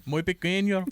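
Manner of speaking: mock Spanish accent, laughing; bad Spanish accent. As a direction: toss it off in a joke Spanish accent.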